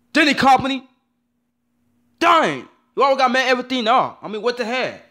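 high, loud exasperated outburst; outraged outburst. Real, not acted. Upset fan yelling at Disney, high and loud. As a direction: blow up at the company in disbelief.

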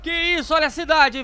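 high loud urgent shouting; panicked gamer shouts; alarmed. Real, not acted. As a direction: yell in alarm at the chaos. Alarmed yelling.

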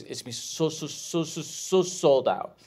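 stretched-out repeated "so", slowing to "sold out"; gushing repetition. Retold or imitated. Fan promising the comedian sold-out shows, gushing repetition. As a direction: hammer each "so" in mock gushing.

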